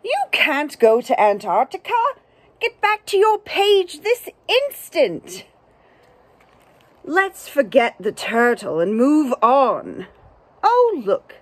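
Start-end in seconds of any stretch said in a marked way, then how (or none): 0.0-5.4 stern scolding, jumping higher on the second line; frustrated narrator. Acted. Book narrator scolding the turtle heading off, mildly cross. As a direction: scold the runaway like a cross teacher. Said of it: "the narrator might get a little frustrated".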